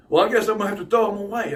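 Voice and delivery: hammed-up curt cashier; mock snippy clerk. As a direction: rattle it off dismissively. Clerk voiced, fast loud.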